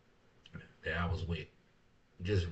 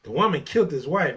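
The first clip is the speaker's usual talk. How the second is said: mocking disbelief; 4 semitones higher, 6 dB louder than usual. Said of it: "Man, you must be high".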